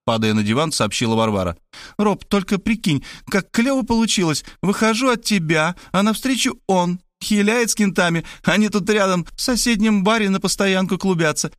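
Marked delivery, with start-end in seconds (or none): 2.0-11.5 put-on high girlish voice, gossiping eagerly; excited young woman. A narrator's reading. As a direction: dish the news in slangy, high girl talk. (высокий девичий голос, увлечённо рассказывает)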